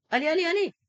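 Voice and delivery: excited cheering. Real, not acted. Cheering on a player.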